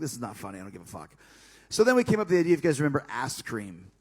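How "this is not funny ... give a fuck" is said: low, quiet, deadpan refusal; cold refusal. Retold or imitated. Refusing the gag, low and quiet. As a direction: drop the voice flat and cold.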